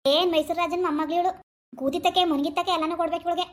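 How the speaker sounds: normal talking voice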